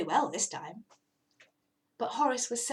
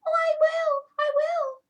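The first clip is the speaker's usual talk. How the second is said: very high, louder eager promise; eager cat promise; 7 dB louder than usual. Acted. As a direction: promise anything to get out.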